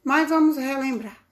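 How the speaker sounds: normal talking voice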